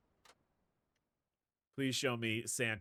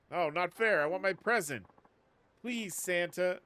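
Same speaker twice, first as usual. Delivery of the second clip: put-on high, louder whining child; whiny kid; 6 semitones higher, 5 dB louder than usual. Streamer voicing children begging Santa, whiny. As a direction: beg like a sulky kid.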